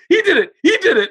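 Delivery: high, loud mock whimper; mock-crying while ratting out a friend; whiny snitching. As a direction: whine it like a scared kid caving. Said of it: "I folded so quick".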